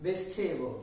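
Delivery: ভেজিটেবল as bej-thay-bol; soft bej-THAY-bol for ভেজিটেবল; correct pronunciation. Shown as a demonstration. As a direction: say ভেজিটেবল in three beats, puffed t. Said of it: "কি হবে?".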